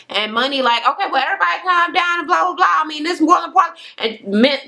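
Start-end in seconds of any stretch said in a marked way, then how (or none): 0.9-3.8 mock shout. Money calming a brewing fight.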